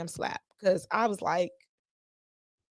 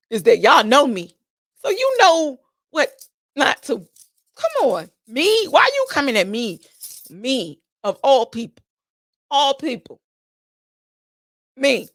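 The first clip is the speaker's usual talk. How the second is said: loud, higher, slowed on me; indignant outrage; 4 semitones higher, 7 dB louder than usual. Real, not acted. Asking why he came at her of all people, loud indignation. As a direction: stress every me in disbelief. Outraged at being targeted personally.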